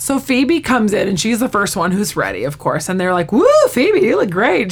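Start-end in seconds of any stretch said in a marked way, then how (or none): 3.3-4.7 high, loud "woo" of approval; admiring whoop. Retold or imitated. Friends greet Phoebe as she walks in ready, admiring whoop. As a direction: cheer a dressed-up friend.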